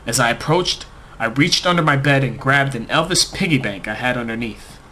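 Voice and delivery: ordinary delivery, nothing marked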